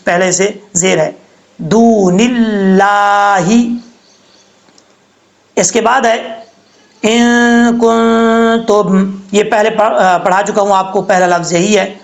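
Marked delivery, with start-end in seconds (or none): 1.6-3.5 دون اللہ with a thin, light lam in اللہ; slow thin Allah demo. Shown as a demonstration. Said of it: "بریک پڑھیں گے". 7.0-9.1 ان کنتم with the nun hidden in a nasal hum; slow ikhfa demo. Shown as a demonstration. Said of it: "اخفا ہوگا".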